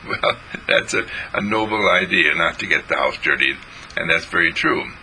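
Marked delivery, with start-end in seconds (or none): none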